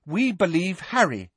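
plain, earnest assurance; simply. A narrator's reading. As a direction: state loyalty without fuss. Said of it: "said Neville simply".

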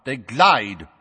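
sharp. A narrator's reading. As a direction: correct the witness firmly. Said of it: "said Madam Bones sharply".

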